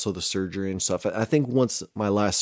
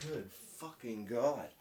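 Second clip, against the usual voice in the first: turning away from phone; 15 dB quieter, slower than usual. Real, not acted. Steps away from mic, exasperated.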